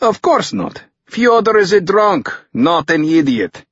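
put-on high, emphatic Russian-accented retort; indignant Russian interpreter. A narrator's reading. Dmitri defends his drunk friend to Tucker, indignant Russian. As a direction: pitch it up, Russian accent, dismissive.

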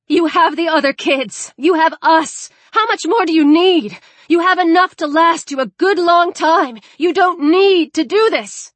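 high, loud, urgent appeal; desperate pleading. Acted. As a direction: bargain desperately for a life. Pleading for the boy's life.

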